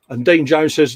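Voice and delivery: normal talking voice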